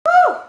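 sudden high, loud "woo"; loud, high-pitched woo; whoop. Real, not acted. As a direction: let out a big high whoop. Sudden wordless whoop between remarks.

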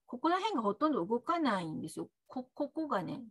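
ordinary delivery

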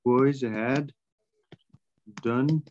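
slow chunks with pauses between; word-by-word dictation. Real, not acted. Teacher giving the class an answer, word-by-word dictation. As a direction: dictate in small pieces, leaving gaps.

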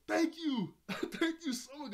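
high, loud mock-tearful thanks; hammed-up weepy shout; tearful gratitude. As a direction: play an overwhelmed, crying thank-you. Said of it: "And he starts to cry".

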